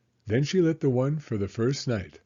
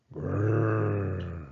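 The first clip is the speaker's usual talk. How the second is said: bear growl; 2 semitones lower than usual. Acted. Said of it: "he growled".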